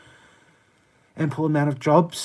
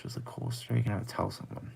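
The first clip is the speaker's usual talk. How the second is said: whispered under the breath; reading it out in a hush; 4 semitones lower, 12 dB quieter, faster than usual. Real, not acted. Streamer reading a chat message in a whisper.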